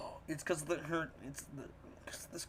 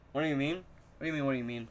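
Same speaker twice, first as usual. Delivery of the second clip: ordinary talk, nothing marked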